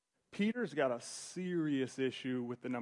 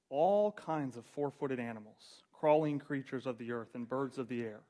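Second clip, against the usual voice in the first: normal talking voice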